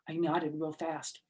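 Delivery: usual speaking voice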